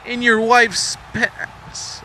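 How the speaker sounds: high, drawn-out quote; acted catchphrase. Quoting a show's catchphrase, high and slow. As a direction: stretch it out high, like a quoted punchline.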